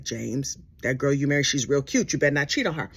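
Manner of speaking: low, mock-motherly warning to James; stern warning. A hint of a stern church lady warning James. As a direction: wag a finger at him, voice dropped low.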